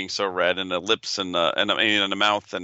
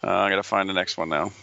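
usual speaking voice

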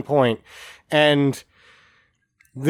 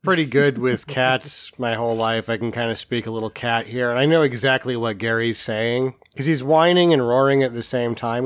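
ordinary talk

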